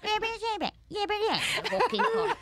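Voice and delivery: very high, loud goblin caricature; insulting high-pitched mimicry. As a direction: repeat it like a manic mocking goblin. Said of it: "sound like a [name] cross with a goblin".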